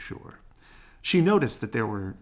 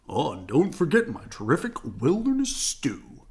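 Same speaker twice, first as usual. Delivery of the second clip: Papa Bear voice; 4 semitones higher, 5 dB louder than usual. A narrator's reading. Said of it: "said Papa".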